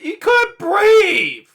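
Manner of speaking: high, very drawn-out astonishment; amazed reaction. Real, not acted. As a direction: stretch it out high in real amazement. Listener amazed at breathless story.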